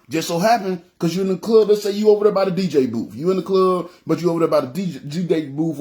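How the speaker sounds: usual speaking voice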